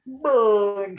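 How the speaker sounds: BANG drawn out long; slow motion. Retold or imitated. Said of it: "You were doing it really slowly".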